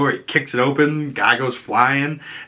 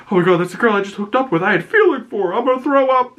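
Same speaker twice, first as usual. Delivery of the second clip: mock distraught whine; 11 semitones higher, faster than usual. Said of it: "pissed because he's like".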